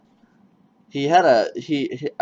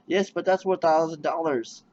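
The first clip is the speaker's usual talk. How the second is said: mock clerk; 6 semitones higher than usual. Retold clerk.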